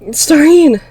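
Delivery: high, loud delighted call of a name; joyful cry. A narrator's reading. Girl overjoyed at finding her sister, crying her name. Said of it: "My voice was filled with joy".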